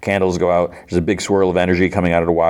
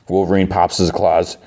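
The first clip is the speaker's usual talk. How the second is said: normal talking voice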